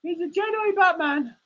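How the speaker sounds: high squeaky meerkat puppet; high puppet voice for Alan. Acted. Streamer voicing his meerkat puppet, high and cheeky.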